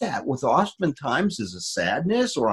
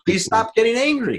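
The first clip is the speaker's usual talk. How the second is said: higher, pleading plea; a wife begging her angry husband to calm down; mock pleading wife; 9 semitones higher than usual. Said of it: "What does the woman want?".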